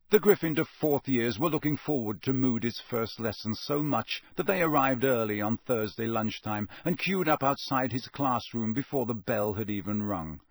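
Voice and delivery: ordinary delivery, nothing marked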